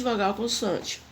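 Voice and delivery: normal talking voice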